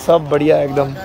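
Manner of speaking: ordinary talk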